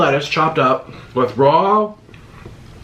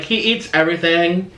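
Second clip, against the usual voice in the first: ordinary delivery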